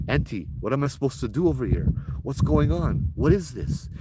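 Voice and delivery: mild retold pleading; urgent plea. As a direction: driver grabbing the psychic, asking what to do. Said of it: "I'm telling her".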